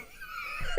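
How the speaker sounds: high squeaky laugh; squealing laugh. Real, not acted. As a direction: burst into a shrill laugh. Laughs after shouting.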